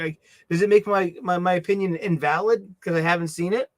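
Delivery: normal talking voice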